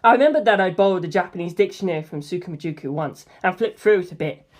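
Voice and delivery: ordinary delivery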